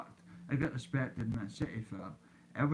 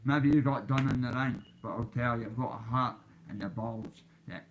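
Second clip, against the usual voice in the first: normal talking voice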